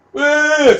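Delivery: a loud, high whoop; mock whoop. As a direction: whoop as if cheering the slap. Cheering the imagined smack.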